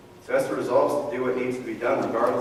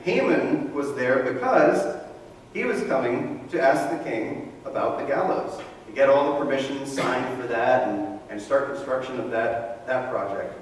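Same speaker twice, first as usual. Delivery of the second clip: ordinary talk, nothing marked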